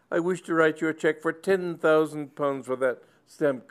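put-on high, loud old gentleman overcome with emotion; an elderly squire gushing through tears; tearful old squire. Sir Leopold to the curate, gushing high and loud.

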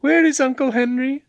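cheerful high-voiced young woman. A narrator's reading. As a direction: ask brightly in falsetto. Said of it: "she cried gaily".

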